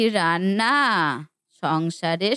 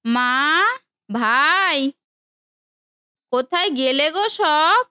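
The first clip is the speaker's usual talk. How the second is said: high, loud call into the house, a bit fast; 9 semitones higher, 4 dB louder than usual. Acted. Sister arriving calls out to family inside, loud high call. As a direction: call out for the family as you walk in.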